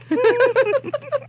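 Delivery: squeaky burst of laughter; high giggle. Real, not acted. Colleague giggling at her own cat joke.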